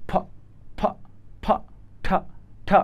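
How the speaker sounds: ফ and ঠ with strong puffs; aspirated demo. Shown as a demonstration. Teacher blowing onto paper showing aspiration. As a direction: blow out hard on ফ and ঠ. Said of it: "কাগজটা কিন্তু নড়তেছে".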